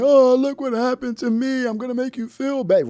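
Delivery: high, loud mock whine; sarcastic high whiny imitation; mocking whiny victim.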